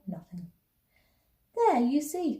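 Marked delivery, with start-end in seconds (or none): 1.6-2.4 put-on higher-pitched girl's exclamation; crying out. A narrator's reading. Anna crowing to her family on the train, crying out. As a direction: cry it out, a pitched-up child. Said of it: "cried Anna".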